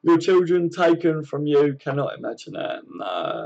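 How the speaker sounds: ordinary talk, nothing marked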